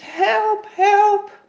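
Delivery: high, loud desperate cry for help; shouting help from the bottom of a well. A narrator's reading. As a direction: call up out of the pit. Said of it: "hij roept".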